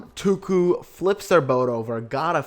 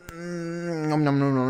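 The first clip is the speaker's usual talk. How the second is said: mock munching; 2 semitones higher, slower than usual. Eating up a tribe's win.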